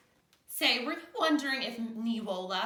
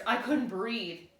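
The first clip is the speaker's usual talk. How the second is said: ordinary delivery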